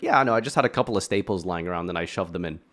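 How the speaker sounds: quick, low, offhand imitation; mock nonchalant. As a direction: toss it off casually, imitating him. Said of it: "He says that so nonchalantly".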